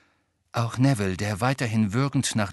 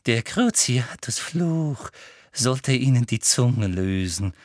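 ordinary delivery

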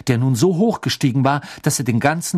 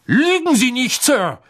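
loud, much higher accusing snap; angry cry; 11 semitones higher, 4 dB louder than usual. A narrator's reading. Mr Crouch accuses Ron of lying, loud and higher. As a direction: call him a liar to his face. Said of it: "rief Mister Crouch".

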